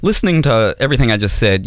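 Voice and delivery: normal talking voice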